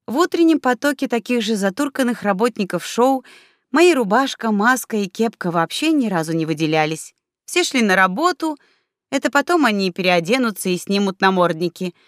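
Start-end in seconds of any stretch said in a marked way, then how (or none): none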